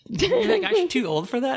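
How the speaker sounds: high, mocking quote voice. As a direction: quote them high and teasing. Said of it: "They're like".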